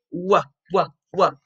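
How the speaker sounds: "Wah" as a short, low wuh; W sound. Shown as a demonstration. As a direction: separate wuh beats for each "Wah". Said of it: "what sound the W make".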